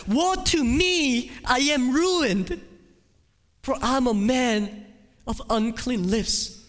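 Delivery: high, loud, slow cry of despair; anguished cry. Acted. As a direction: wail it like a ruined man. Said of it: "you will cry out".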